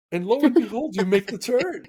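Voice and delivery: officer's punchline, laughing through it; retelling the cop's story through laughter; laughing while quoting. Retold or imitated. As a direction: let the laugh break into the words. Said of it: "he's telling me this while he's laughing".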